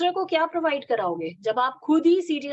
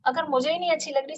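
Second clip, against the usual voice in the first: usual speaking voice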